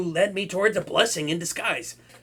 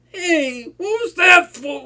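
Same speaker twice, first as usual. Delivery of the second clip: loud, higher, crying out; whiny yelp; 8 semitones higher, 6 dB louder, slower than usual. Acted. Villain hit by his hostage, whiny pained protest. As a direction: protest a sudden smack.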